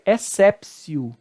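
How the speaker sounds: exceptio with T as S, "essépsio"; correct Latin pronunciation. Shown as a demonstration. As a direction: exceptio pronounced "ek-SEP-sio", measured. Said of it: "agora a correta pronúncia".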